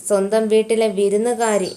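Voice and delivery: plain reading voice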